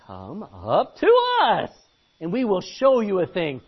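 loud, slow threatening soldier; slow loud sneering challenge; menacing taunt. Acted. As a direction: dare them up with a threat. Philistine garrison taunt.

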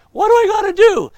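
high, loud pleading cry; loud, high begging question; desperate cry. Retold or imitated. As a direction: shout it as if you've given up everything. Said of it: "he says".

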